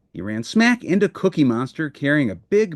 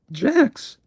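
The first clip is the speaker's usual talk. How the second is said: very high Jacks; sudden realization; excited cry; 12 semitones higher than usual. Acted. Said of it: "cried Grover".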